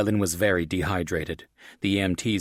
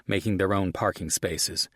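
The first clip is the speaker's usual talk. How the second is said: ordinary delivery, nothing marked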